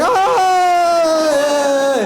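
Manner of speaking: long, high, loud shriek; horrified scream. Retold or imitated. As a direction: scream in comic horror at the confession. Priest misunderstands a shocking confession.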